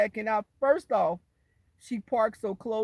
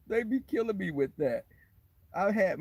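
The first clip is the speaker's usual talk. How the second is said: ordinary delivery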